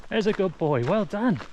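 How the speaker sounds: high, sing-song praise to the dog; bright doggy praise voice. Real, not acted.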